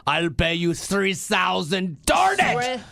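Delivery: exasperated Austrian outburst; frustrated Austrian. Retold or imitated. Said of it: "And he's like".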